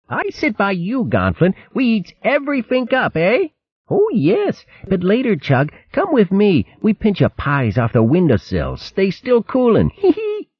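put-on high, squeaky babyish voices; tiny dibbuns scheming to pinch pies; mischievous little ones. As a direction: giggle while plotting mischief. Little ones plot pie stealing.

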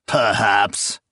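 a curt, scornful single word; understated contemptuous retort; spat contemptuously. A narrator's reading. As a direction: bite it off with disdain. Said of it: "Zarias spat".

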